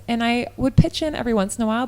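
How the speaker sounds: ordinary delivery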